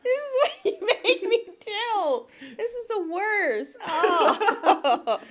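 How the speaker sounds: high, loud wail through laughter; helpless laughing protest. Real, not acted. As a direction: complain while cracking up, stretching the words out. Wailing in laughter at being mimicked.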